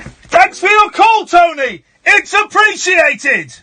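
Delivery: loud mock-cheery send-off; bellowed sarcastic thanks; sarcastic shout. Real, not acted. Host dismisses caller loudly.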